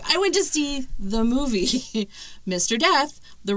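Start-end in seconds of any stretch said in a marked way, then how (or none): none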